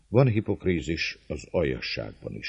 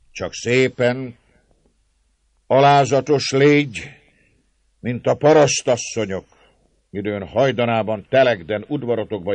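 raised, loud mocking lecture; taunting Márton; 8 semitones higher, 10 dB louder than usual. A narrator's reading. Márton telling the lady to plead humbly, raised taunting voice. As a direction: tell her tauntingly how to beg.

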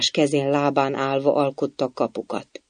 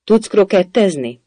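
high, loud demand; raised, imperious voice; shouted question; 5 semitones higher, 5 dB louder than usual. A narrator's reading. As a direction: fire the question out like a queen. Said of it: "kiáltotta a királynő".

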